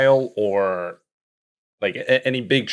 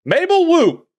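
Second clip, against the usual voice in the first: very high, loud, held-out name call; theatrical suspect reveal; 5 dB louder than usual. Pretend. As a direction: whoop the name out like a show announcer. Game master announces first suspect.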